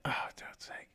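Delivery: frustrated hushed curse; exasperated whisper. Real, not acted. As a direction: whisper Oh, God's sake in frustration. Annoyed at own mistake.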